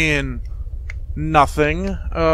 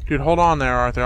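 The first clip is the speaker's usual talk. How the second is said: usual speaking voice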